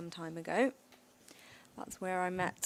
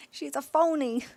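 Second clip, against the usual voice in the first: loud, high, hammed-up Jersey accent; New Jersey accent; 5 semitones higher, 6 dB louder than usual. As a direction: belt out "phony" and "baloney" like a Jersey loudmouth. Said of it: "They say that in New Jersey".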